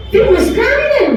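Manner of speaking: fast, very high-pitched irate question; angry demand. Acted. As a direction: challenge the one who is amused, voice shooting up. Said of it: "इतना गुस्से में आ गए". (गुस्से में तीखा सवाल)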